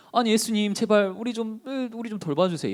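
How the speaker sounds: high-pitched mock plea; reproachful pleading. Disciples in a sinking boat to Jesus, reproachful pleading. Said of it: "이렇게 원망했던 건데".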